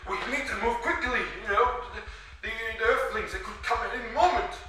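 high-pitched, pressing warning; acted urgency. Tagoma urges haste.

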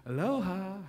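a gentle hint of sing-song aloha; lightly imitating locals. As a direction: mimic the friendly islanders, lightly. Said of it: "everywhere you go, they".

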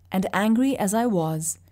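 ordinary delivery, nothing marked

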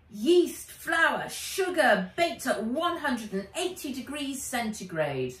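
proud know-it-all announcement; announcing. A narrator's reading. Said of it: "he announced".

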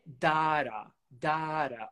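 "data" as DAH-ra with a tapped t; A vowel. Shown as a demonstration. Teacher lists variants of data, ah vowel. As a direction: say "data" with open "ah" and flapped t. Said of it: "Agora com a vogal A".

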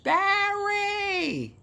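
put-on very high Bronx-accented Barry!; Bronx call, again. Retakes the call to Barry in a Bronx accent. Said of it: "I did it again".

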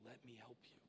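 God offering help, hushed and tender; gentle voice of God. Pretend. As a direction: speak as God, soft and reassuring. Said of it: "he's up there saying".

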